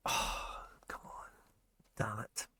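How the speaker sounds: whispered disappointed curse; frustrated whisper. Real, not acted. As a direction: hiss it under your breath, gutted. Disappointed by player pull.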